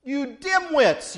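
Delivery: Jesus gently scolding, slow and firm; mock scolding. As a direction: lean into the insult, drawn out. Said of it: "Jesus is saying to them".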